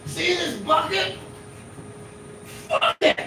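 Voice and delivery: slow, high-pitched hammy setup, then a voiceless burst; drunk flea-market comedian. Acted. Drunk shirtless comedian doing his bucket bit to a passerby, hammy. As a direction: play a drunk hack comic straining up high. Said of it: "obviously visibly drunk comedian".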